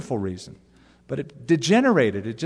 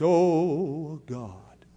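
prayer voice; 3 dB quieter than usual. Retold or imitated. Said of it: "some people have a prayer voice".

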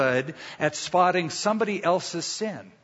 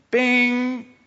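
high chirpy bing, louder; chime sound; 8 semitones higher, 4 dB louder than usual. Retold or imitated. Pastor imitating the car's warning chime, high bing.

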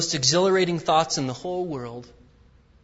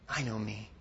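fading, much softer repeat; softer confession; 5 semitones lower, 9 dB quieter than usual. Retold or imitated. David confessing to God, fading to hush. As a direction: let it drop almost to nothing.

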